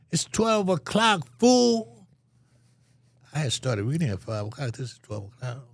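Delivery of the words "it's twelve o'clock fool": high, loud, slow put-down; a neighbor yelling from the next cell; annoyed neighbor shout. Retold or imitated. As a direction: bark it back at him.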